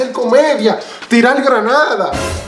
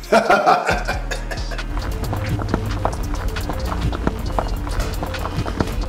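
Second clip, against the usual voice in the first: evil laugh; 4 semitones lower, 9 dB quieter than usual. Acted. Laugh after level three.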